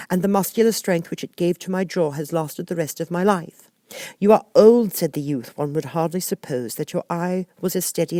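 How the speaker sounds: put-on high girl's voice reciting verse; poem recital. Alice recites Father William to the caterpillar. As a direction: recite it like a schoolgirl.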